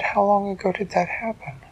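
put-on a young girl hearing sad news; Elise voice.

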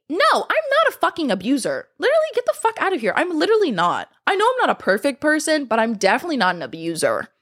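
high, fast indignant denial; high, rushed protest. Real, not acted. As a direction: fire back offended. Denying abuser claim.

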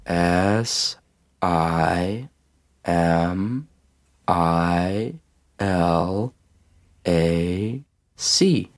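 "S I M I L A C" in stretched letters with long pauses; over-patient spelling of "S I M I L A C", each letter drawn out; extra-slow spelling. A narrator's reading. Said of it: "could you start over".

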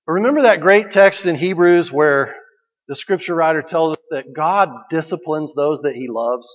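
ordinary delivery

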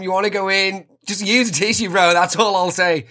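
high, eager blokey banter; overdoing the one-of-the-lads act; hammed-up lad voice. Chatting up the installer with macho bro talk.

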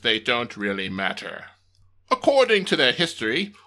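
grand documentary narrator voice; old radio announcer. Acted. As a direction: read it like a newsreel announcer. Dramatic historical narration.

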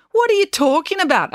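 high, loud disbelief; baffled outburst. A narrator's reading. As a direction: blurt it out, baffled.

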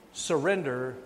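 usual speaking voice

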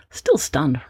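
usual speaking voice

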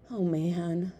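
mildly sighed dismay; resigned sigh. A narrator's reading. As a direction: sigh the words out. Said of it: "Som Kit sighed".

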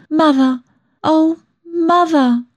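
high, drawn-out tearful call; heartfelt cry. A narrator's reading. Daughter's heart crying for her tired mother, high and aching. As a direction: cry it out high and slow, without shouting. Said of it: "my whole heart seemed just one cry of".

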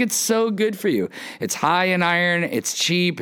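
high mock mom sales pitch; persuading mom. Mom selling liver to her reluctant kid, high and persuasive.

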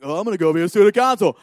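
loud, quick burst of eagerness; eager. Retold or imitated. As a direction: act out an over-keen schoolkid. Acting out his eager younger self.

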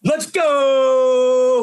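loud, high hype shout; loud high cheer; excited cheer. Real, not acted. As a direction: pump up the crowd. Cheering the podcast launch.

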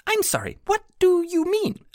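very high, stumbling echo of the question; puzzled pixie. A narrator's reading. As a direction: repeat it baffled, like a tiny fairy.

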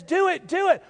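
high, coaxing mock tempter; tempting flesh. Flesh urging the believer to sin, high coaxing voice. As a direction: egg him on like a little tempter.

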